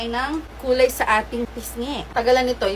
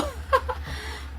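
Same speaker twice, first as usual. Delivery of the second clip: high, soft giggle; real giggle at yourself; amused laugh; 14 semitones higher, 6 dB quieter than usual. Real, not acted. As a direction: let out a high, light laugh. Laughs while posing.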